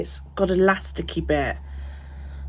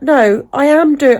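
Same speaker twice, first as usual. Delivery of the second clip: retold protest; 8 semitones higher, 7 dB louder, slower than usual. Retelling her own protest to receptionist.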